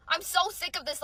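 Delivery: usual speaking voice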